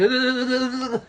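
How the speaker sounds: rattled-off wordless babble, playing possessed; possessed babbling noise. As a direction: make a mock possessed noise, rapid and pulsing. Imitates own possessed act.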